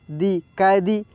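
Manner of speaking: ordinary talk, nothing marked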